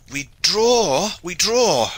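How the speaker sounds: high, loud shouting; exultant cry. A narrator's reading. Shipmaster sees the ship pulling clear, crying out. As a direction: cry out twice with full force. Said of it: "cried Hawtayne".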